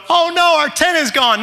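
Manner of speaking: high mock wail of a little boy; crying child. Son still going on about the tent, crying wail. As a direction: imitate a kid still wailing in panic. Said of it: "He's now crying".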